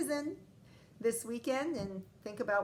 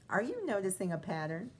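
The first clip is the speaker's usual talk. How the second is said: ordinary delivery, nothing marked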